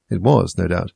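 ordinary delivery, nothing marked

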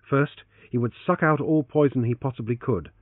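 usual speaking voice

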